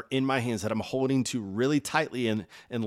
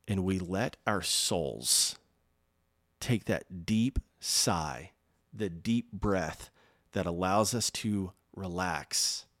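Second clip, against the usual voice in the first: ordinary delivery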